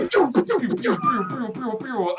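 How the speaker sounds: high mock laser pews; squeaky zap noises for missiles; pew pew sounds. Imitating magic missiles firing.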